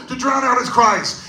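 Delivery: normal talking voice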